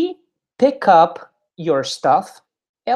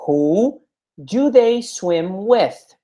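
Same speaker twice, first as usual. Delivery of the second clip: "Who do they swim with?" said at half speed, each word spaced; slow teaching model; 2 semitones higher, much slower than usual. Shown as a demonstration. Teacher models the question slowly for the class, drawn out. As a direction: stretch every word out for learners. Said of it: "slow model again".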